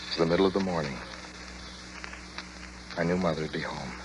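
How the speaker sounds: low, soft and slowed, clinging to hope; hushed longing. Acted. Driver longing for his mother's calm voice, soft and low. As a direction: murmur it as if holding yourself together.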